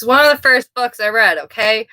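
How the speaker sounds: normal talking voice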